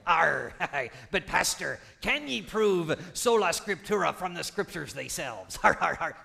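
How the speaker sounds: hammed-up pirate growl with laughs. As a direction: play a jokey pirate heckling the pastor. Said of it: "in that, uh, pirate voice that you like to use".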